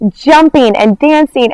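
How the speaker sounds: normal talking voice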